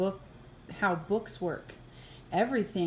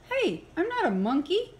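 higher, offended denial; indignant protest; 9 semitones higher than usual. Acted. Reader objecting to being called a monkey, indignant. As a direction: push back, insulted.

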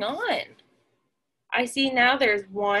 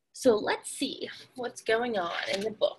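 whisper; 3 semitones lower, 7 dB quieter than usual. Real, not acted. Whispered aside to children.

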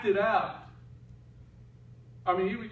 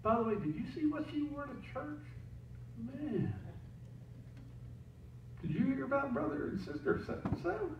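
gossipy whisper; 6 semitones higher, 10 dB quieter than usual. Retold or imitated. Gossiping hypocrite in foyer.

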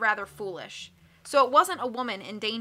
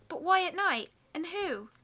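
higher, wondering lady; puzzled woman's voice; 7 semitones higher than usual. A narrator's reading. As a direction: ask as if baffled.

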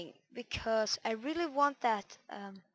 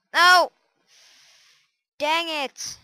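frustrated yell; 6 semitones higher, 17 dB louder, much slower than usual. Real, not acted. Failed a parkour jump.

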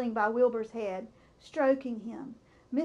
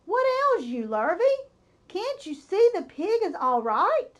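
loud, higher drenched farmer; drenched farmer yelling at Lurvy; bellowed; 6 semitones higher, 4 dB louder than usual. A narrator's reading. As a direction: bellow at the hired man. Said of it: "bellowed Mister Zuckerman".

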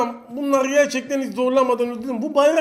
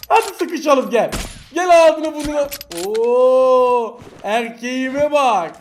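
slow, louder mocking dare; slow teasing swagger, louder; cocky taunt; 4 dB louder, much slower than usual. Real, not acted. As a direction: goad the enemy to come closer. Taunting enemy in close fight.

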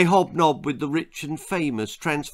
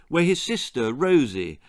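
usual speaking voice

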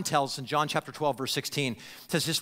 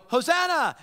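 higher, louder call of Hosanna; calling greeting; 10 semitones higher, 5 dB louder than usual. Retold or imitated. Pilgrim hailing a newcomer on the road, bright call. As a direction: hail a traveler on the road. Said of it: "somebody would go".

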